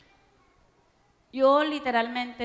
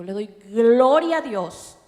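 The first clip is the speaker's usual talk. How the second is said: louder, slow cry of praise; 11 dB louder, slower than usual. Acted. Preacher giving thanks to God before the church, loud and slow. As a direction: proclaim it to the whole church.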